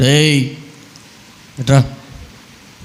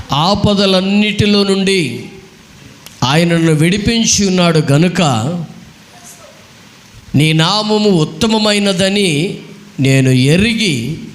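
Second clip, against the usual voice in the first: higher, long-drawn verse recitation; emphatic scripture recitation; 4 semitones higher, slower than usual. Real, not acted. Preacher repeats the psalm before quizzing listeners, emphatic scripture recitation. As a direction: lift the pitch and stretch each phrase.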